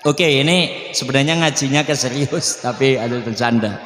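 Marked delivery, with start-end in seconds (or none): none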